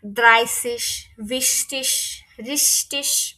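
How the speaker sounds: "দ্রাইসিস" said slowly, ending in a sh hiss. Shown as a demonstration. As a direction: end "ভিস্টিস" and "রিস্টিস" on sh. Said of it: "তাহলে শয়ের মতো উচ্চারণ হবে".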